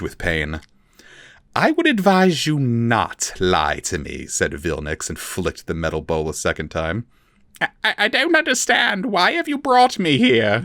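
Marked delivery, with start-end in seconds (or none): 1.5-4.3 slow, cold warning; slow threat. A narrator's reading. Vilnix warns the captive elf, slow cold menace. 7.6-10.6 much higher, stammering frightened elf; panicked little creature; Forficule voice. A narrator's reading. The terrified elf begs his captor, high and stammering.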